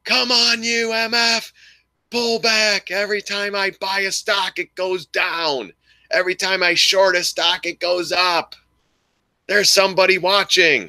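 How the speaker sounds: very high, strained yell at the screen; mock screaming. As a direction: re-enact a trader wailing at the screen in frustration. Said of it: "I used to scream at the screen".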